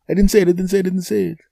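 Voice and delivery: high, rapid-fire protesting; frantic denial. Retold or imitated. Wrongly accused man protests to the furious lady, frantic. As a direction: repeat it in a high, panicky stream.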